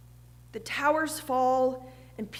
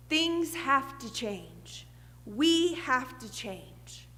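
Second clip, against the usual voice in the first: slow solemn; much slower than usual. Retold or imitated. Jesus's solemn urgent call.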